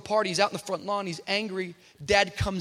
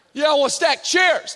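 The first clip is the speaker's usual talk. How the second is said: loud, high, quick challenge; tough kid confronting; 8 semitones higher, 10 dB louder than usual. Retold or imitated. Tough young guy challenging his pastor, loud and high. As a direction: get in his face with it. Nelson insists.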